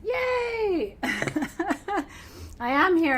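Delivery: high, loud yay of delight; thrilled little whoop; excited cheer. Real, not acted. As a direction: cheer like the stream just worked. Going live, cheering arrival.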